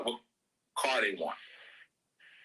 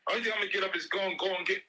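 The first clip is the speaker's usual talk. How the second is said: higher, mock-outraged parent, fast at the end; exasperated parent rant; 7 semitones higher than usual. Parent mock-scolding a child who says he is thirsty. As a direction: scold the lazy kid, half-laughing.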